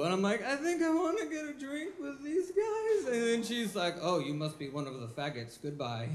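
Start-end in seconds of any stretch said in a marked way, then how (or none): 0.4-3.1 high, innocent nerd voice; naive guy voice. Retold or imitated. 4.0-6.1 low, bored brush-off; dismissive stripper. Retold or imitated.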